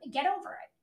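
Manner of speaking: ordinary talk, nothing marked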